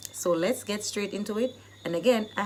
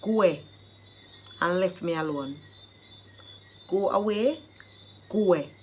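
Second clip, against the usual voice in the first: Jamaican patois. Shown as a demonstration. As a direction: chase them off in Jamaican patois. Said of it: "the Jamaican dialect phrase".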